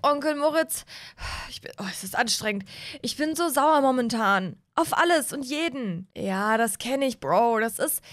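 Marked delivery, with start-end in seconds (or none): 1.5-2.6 breathless whisper from running; panting on the treadmill; out of breath. Acted. Teen on the treadmill complaining to his uncle, out of breath.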